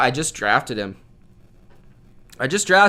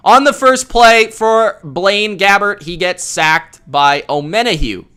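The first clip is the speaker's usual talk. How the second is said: loud, raised play-by-play call, easing at the end; live play-by-play; 6 semitones higher, 7 dB louder, slower than usual. Real, not acted. Streamer calls a sack for viewers, excited play-by-play. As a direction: call the sack as it happens, slow and loud at first.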